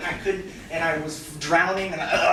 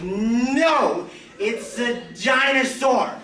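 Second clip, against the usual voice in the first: laughing refusal; 7 semitones higher, much slower than usual. Retold or imitated. Refusing the manager's order to swim, slow laughing shout.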